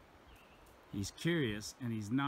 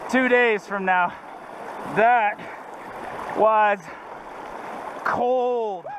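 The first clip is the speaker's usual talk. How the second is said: high, loud and breathless; gasping from icy water; breathless after cold water; 13 semitones higher, 10 dB louder, slower than usual. Real, not acted. Just crossed a freezing river.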